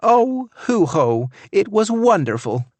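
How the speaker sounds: wife gushing to the greeter; gushing exclaim. A narrator's reading. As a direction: gush over the trip. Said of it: "Tammy exclaimed".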